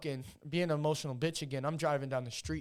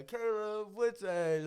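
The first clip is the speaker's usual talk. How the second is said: higher, drawn-out name call; ring announcer imitation; 7 semitones higher, 3 dB louder, much slower than usual. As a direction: announce the name, stretching it out slowly. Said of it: "I did the peekaboo, like, uh".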